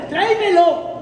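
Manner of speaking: commanding echo. Pretend. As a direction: press "tráemelo" hard. Order repeated.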